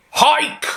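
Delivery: high sharp karate yell; a high loud martial-arts shout; karate cry. Acted. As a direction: bark it like a chop. Karate chop battle cry.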